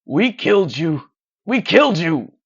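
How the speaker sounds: loud, pained scream; wounded man screaming out. A narrator's reading. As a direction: scream it through the pain. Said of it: "and he screams".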